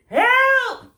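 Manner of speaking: very high, loud shriek; the others shrieking as water falls. Acted. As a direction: shriek in fright. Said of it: "shrieked the others".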